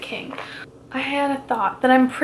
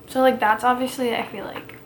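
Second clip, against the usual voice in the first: ordinary talk, nothing marked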